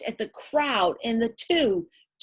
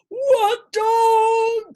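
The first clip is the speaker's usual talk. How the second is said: loud high shout; men yell at the muddy dog; cried out; 12 semitones higher, 9 dB louder than usual. A narrator's reading. Band members shout at the dog, high and loud. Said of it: "cried the men".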